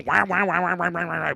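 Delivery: mocking the teacher's wah-wah sound; Peanuts teacher wah-wah. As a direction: imitate the muffled Peanuts teacher noise. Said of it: "that stupid teacher".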